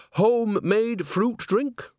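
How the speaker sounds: very high, slow, coaxing offer; fake-innocent server. A narrator's reading. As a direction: draw out the offer in a piping voice. Fake-innocent witch offers spiked drink.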